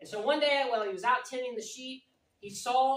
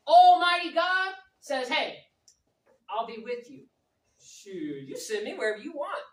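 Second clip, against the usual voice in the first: bold swagger; 5 semitones higher, 8 dB louder than usual. Pretend. Bold man answering God.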